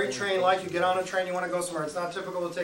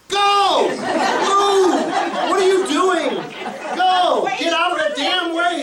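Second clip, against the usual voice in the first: loud, high exasperated shout; re-enacted urgent, high-pitched yelling; 9 semitones higher, 7 dB louder than usual. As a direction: yell commands to clear a blocked doorway. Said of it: "what are you yelling at me for".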